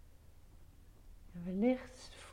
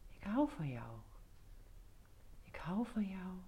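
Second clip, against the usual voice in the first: fading tender whisper; 5 dB quieter than usual. Acted. Repeated affirmation fading away.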